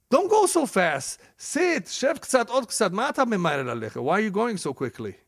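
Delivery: hammed-up insincere host pleading; overly sweet host. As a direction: beg the guest to stay, sugary and fake. Host faking courtesy to leaving guest.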